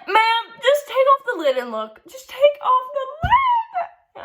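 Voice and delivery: high, pleading, at the end of her rope; near-tearful exasperated retelling to the customer; exasperated whine. Retold or imitated. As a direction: beg her to just look under the lid.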